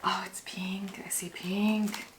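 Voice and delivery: excited near-whisper; very soft, whispered delight at a first peek; hushed excitement. Real, not acted. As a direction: whisper it in wonder. First peek inside the package.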